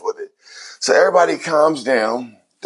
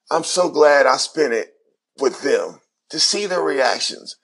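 ordinary talk, nothing marked

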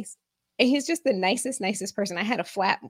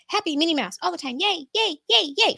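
higher, sugary Minnie Mouse cheer; mock her friend's chirpy work voice; Disney cheerfulness; 8 semitones higher than usual. Imitating her friend's forced Disney work cheer, chirpy. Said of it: "You have to be Disney at work".

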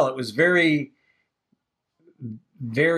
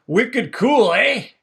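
higher, louder hammed-up Boston accent; mock Boston accent; 7 semitones higher, 4 dB louder, much slower than usual. Joking about colonists talking like Bostonians, mock Boston accent. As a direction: put on a playful Boston accent, pitched up. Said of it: "Like they do in Boston".

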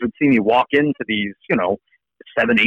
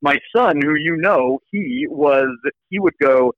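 ordinary talk, nothing marked

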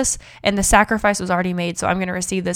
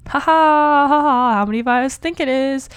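high, louder sarcastic ha ha; mock laughter; 6 semitones higher, 4 dB louder than usual. Host mocking the listeners' belief with a high fake laugh. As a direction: laugh off the silly idea.

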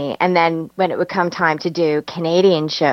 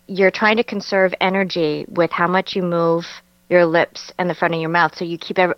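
ordinary talk, nothing marked

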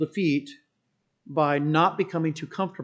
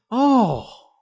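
very high, loud, drawn-out mimicked joy; hammed-up elated whoop; mock gushing excitement; 14 dB louder, much slower than usual. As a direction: imitate an overeager man shouting his delight. Mimics golfer's gushing, oblivious reply.

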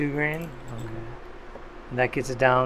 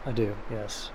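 normal talking voice

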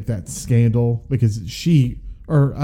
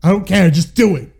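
loud, high, impatient director; impression of a director who just wants it shot; Gary Marshall; 6 semitones higher, 6 dB louder, faster than usual. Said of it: "Gary Marshall was like".

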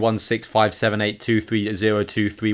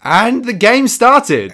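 high, slow amazed exclamation; surprised; 12 semitones higher, 8 dB louder, slower than usual. Real, not acted. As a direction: gasp it out in disbelief. Surprise as game starts.

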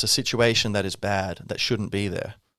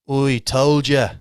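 put-on slow, loud, higher Irish accent; a pharaoh gloating in a thick Irish accent, slowly; Ramses with an Irish accent; 4 semitones higher, 7 dB louder, much slower than usual. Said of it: "That's Ramses with an Irish accent".

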